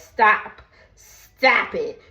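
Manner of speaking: loud, firm stop. Retold or imitated. Friend loudly shutting down Kelly's group chat tease. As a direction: cut her off loud. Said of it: "And she's like".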